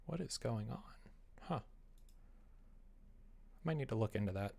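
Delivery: usual speaking voice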